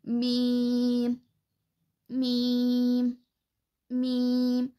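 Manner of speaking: मीम slow, from the dry part of both lips; मीम: lips closed, long ee; Arabic meem. Shown as a demonstration. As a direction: hum मीम slowly on the lips. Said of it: "जो मीम है ये सूखे हिस्से से".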